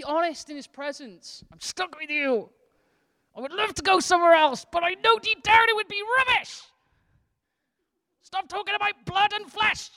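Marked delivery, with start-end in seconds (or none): 1.5-9.9 exasperated honesty. Pretend. Honest reluctant prayer to God.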